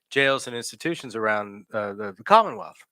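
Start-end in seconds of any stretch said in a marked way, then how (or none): none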